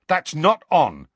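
slow, high, loud angry objection. A narrator's reading. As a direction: object with real heat. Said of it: "said Ron angrily".

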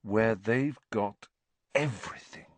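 rapt daydreamer; dreamy. A narrator's reading. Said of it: "a dreamy look coming over his face".

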